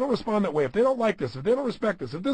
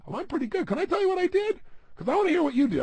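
higher, smiling and light, eager to share; smiling modest brag; 12 semitones higher than usual. Retold or imitated. A self-help speaker showing how to praise yourself elegantly, with a smile and a much higher voice. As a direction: brag gently with a grin and an open heart. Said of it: "with a little smile on your face".

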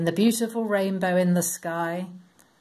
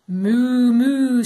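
loud, higher cow moo; 5 semitones higher, 7 dB louder than usual. Acted. Cows excited to board the ark, loud mooing. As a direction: call out a big cheerful moo. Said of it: "said the cows".

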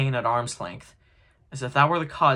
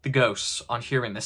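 plain reading voice

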